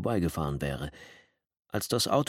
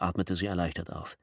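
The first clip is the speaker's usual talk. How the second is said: ordinary delivery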